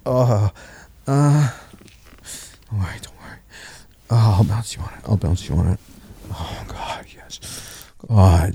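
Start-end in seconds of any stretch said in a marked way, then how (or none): 2.7-3.4 soft reassuring whisper. Acted. Intimate reassurance in ear. 6.3-7.9 breathless whispered gasp; whispering. Acted. Intimate moment, overwhelmed.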